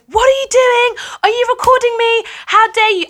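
high, loud, outraged challenge. Retold or imitated. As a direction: imitate an indignant woman confronting someone. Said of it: "starts raising her voice".

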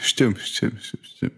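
fading to a breath. Pretend. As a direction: trail off into silence. Fading repeated agreement.

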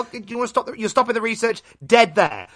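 usual speaking voice

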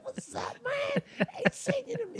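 high strained, softened mock shout; all excited. Guy thrilled to see his friend walk in, all excited. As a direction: imitate a giddy pal squealing hello. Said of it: "He got all excited".

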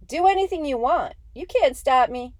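loud, high squeaky shout. Acted. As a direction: yell the taunt in a squeaky voice. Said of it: "he shouted at Rowe in that squeaky voice of his".

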